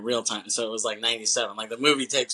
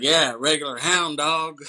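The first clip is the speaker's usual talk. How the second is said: higher, louder imitation of a barfly's jeer; mock drunk heckler; 4 semitones higher, 6 dB louder than usual. Podcaster retelling the drunk's reply to the wife, mock drunk heckler. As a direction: lightly play the taunting drunk. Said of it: "And then the guy's like".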